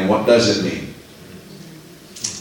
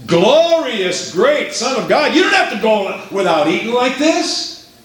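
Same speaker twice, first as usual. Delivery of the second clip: tempting devil; 10 semitones higher than usual. Acted. Devil tempting starving Jesus.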